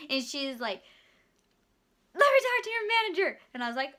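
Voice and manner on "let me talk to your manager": raised, higher and louder demand; demanding customer. Retold or imitated. Customer demands manager from cashier, loud and high. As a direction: insist on the manager.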